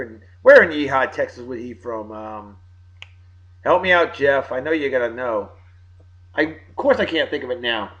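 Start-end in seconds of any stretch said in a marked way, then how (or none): none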